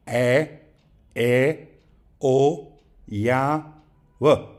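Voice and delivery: each vowel sounded alone, from "a" to "w", with pauses; isolated vowel sounds. Shown as a demonstration. Teacher listing English vowel sounds for learners, isolated vowels. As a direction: recite the vowels one by one, separately. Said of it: "The vowel sounds in English are".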